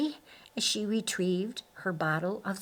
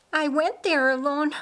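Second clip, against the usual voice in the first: insistent; 7 semitones higher, 5 dB louder than usual. A narrator's reading. Mary insisting to Ben.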